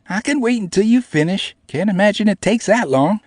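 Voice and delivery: high, loud mocking small girl. A narrator's reading. Namie mocking the narrator about the weights, high and loud. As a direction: tease him mercilessly in a high voice.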